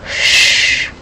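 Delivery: long soft "shh"; shushing hiss. Pretend. Player telling guys they gotta whisper, soft shushing. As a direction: hush the listeners playfully.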